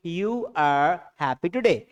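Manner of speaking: drawn-out, deliberate English; teacher repeating the sentence very slowly; very slow model sentence. Shown as a demonstration. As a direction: say each word slowly so they copy it. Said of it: "क्या बोलेंगे?".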